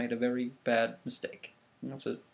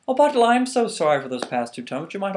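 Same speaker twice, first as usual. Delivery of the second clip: mock apology; 7 semitones higher, 8 dB louder than usual. Eshu feigns apology.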